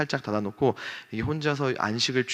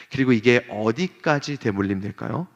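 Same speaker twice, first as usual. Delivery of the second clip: usual speaking voice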